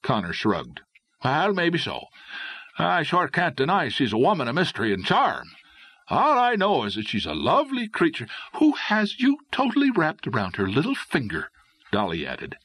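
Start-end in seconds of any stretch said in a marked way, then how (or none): 1.2-5.5 higher-pitched, admiring Connor figure; lightly voiced charmed adventurer; easygoing young Irishman. A narrator's reading. 6.1-11.5 high, pointed woman's voice; jealous girlfriend. A narrator's reading.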